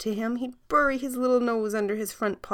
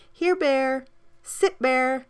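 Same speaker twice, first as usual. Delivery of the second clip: put-on 1 girl calling dog; 8 semitones higher, 3 dB louder, slower than usual. A narrator's reading.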